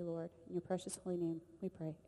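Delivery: ordinary talk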